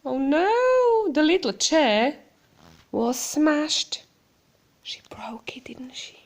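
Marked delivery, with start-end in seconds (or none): 4.8-6.2 breathy conspiratorial whisper; hushed aside, very soft. Acted. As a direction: lean in and whisper the secret. Hushed aside to child during story.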